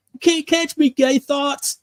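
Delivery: cartoon voice. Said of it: "he's like".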